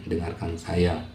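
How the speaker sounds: normal talking voice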